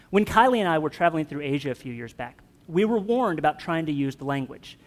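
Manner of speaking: ordinary talk, nothing marked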